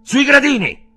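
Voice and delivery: loud high order; commanding shout. Acted. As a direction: command them to sit down, loud. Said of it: "fu costretto a intimare".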